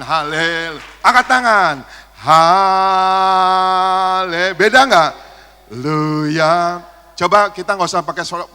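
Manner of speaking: loud, high calls to the congregation; lively worship leading. Real, not acted. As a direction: rouse the whole church to cheer and clap. Leads congregation in loud praise. (memimpin sorak pujian dengan suara keras dan tinggi)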